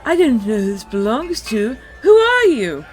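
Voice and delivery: high and loud, hammed-up elf squeal; horrible high elf voice. Narrating the recap, voicing the elf on the stone: high shrill elf. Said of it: "in a horrible high elf voice".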